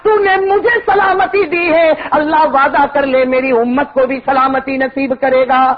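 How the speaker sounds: high, slow, sing-song sermon delivery; full-force preaching. Real, not acted. Preacher voicing the Prophet's plea for his ummah, impassioned chanting. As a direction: intone it like a plea to heaven, drawing out phrases.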